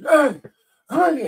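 high, loud hail from the dark; breathless calling out. Retold or imitated. Drunk neighbor hurrying up the dark street, calling out loud. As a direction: call out hard, winded from running. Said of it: "you can tell the person's out of breath".

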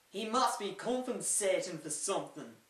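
a lightly done, bad Scottish accent. Quoting Shrek at Farquaad's castle in a bad Scottish accent. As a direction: quote Shrek in a half-hearted Scottish accent. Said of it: "that's a very bad Scottish accent".

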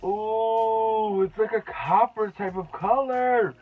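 real delighted squeal; thrilled gasp. Real, not acted. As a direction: gush over the colour. Thrilled first look at shade.

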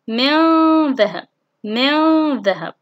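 very slow من ذهب with drawn-out nasal noon; ikhfa demonstration. Shown as a demonstration. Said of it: "الإخفاء". (إخفاء النون بغنّة طويلة في من ذهب)

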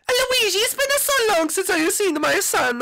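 high falsetto mother voice; squeaky doting mom. Acted. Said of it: "Mama Luigi".

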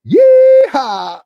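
high loud cowboy whoop; cowboy yeehaw. Acted. The dog riding a goat whoops, high and loud.

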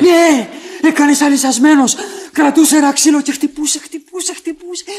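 high, agitated eyewitness account; horrified report. Acted. Witness tells of Ugo beating someone, horrified and agitated.

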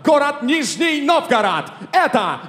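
rising to a long high shout; building announcer yell. Acted. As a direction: build to a roaring name call. Announcer builds to name call.

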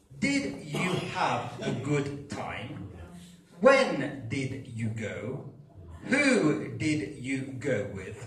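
very slow, word by word, each word separated; overly careful slow classroom English; exaggeratedly slow. Shown as a demonstration. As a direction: spell out each question slowly like a textbook drill. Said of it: "E nessuno dirà".

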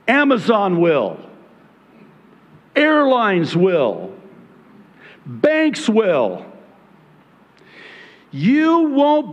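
slow, pointed reading of each line; emphatic quoting. Retold or imitated. Pastor reading a warning quote to his congregation, slow and emphatic. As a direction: spell it out word by word for the congregation. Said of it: "and I'm still quoting".

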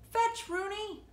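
high call of fetch; calling the dog. A narrator's reading. Larry calling to the leashed dog, high eager call. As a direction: call out to the puppy.

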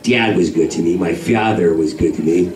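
low, laid-back LA speak; LA talk. Retold or imitated. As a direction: imitate a mellow Los Angeles local. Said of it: "That's how they talk out here in L A".

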